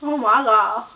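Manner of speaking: talking while brushing teeth; toothbrush in mouth. Real, not acted. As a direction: mouth full of toothpaste foam. Seen 1: Both women brush with dark toothpaste; the speaker is unclear.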